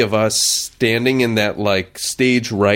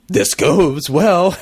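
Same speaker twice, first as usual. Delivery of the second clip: higher, drawn-out anxious wish; mock nervous hope; 7 semitones higher, 3 dB louder, much slower than usual. Actor retelling waiting out the first scene, drawn-out mock-nervous hope. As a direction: stretch it out slowly, comically anxious. Retold nerves waiting in the wings.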